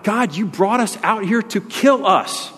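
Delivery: high, slow grumbler's protest to God; drawn-out, high-pitched voiced reproach; acted accusation. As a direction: complain upward in mock outrage. Voicing Israelites' accusation against God.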